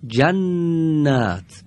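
جنت drawn out very slowly, doubled ن held; slow gemination demo. Shown as a demonstration. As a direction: stretch جنت, lean on the double n. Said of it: "همین حرف دارای تشدیده".

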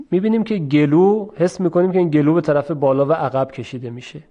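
ordinary talk, nothing marked

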